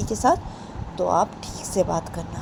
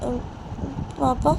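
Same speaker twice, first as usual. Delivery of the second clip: put-on high, pleading little girl's voice; a hurt child begging her papa; little daughter; 11 semitones higher than usual. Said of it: "बोलती है कि". (बेटी सोनम पापा से ऊँची, मिन्नत भरी आवाज़ में पूछती है)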